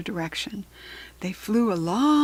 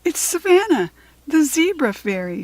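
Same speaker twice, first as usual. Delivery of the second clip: bright fairy introducing herself, higher; lightly voiced fairy; 7 semitones higher, 5 dB louder than usual. A narrator's reading. Fairy greeting the girls with a lightly raised voice. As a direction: say her name like a cheerful fairy.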